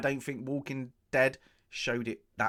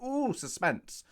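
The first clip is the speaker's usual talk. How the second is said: mock awed; 9 semitones higher, slower than usual. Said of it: "You're like".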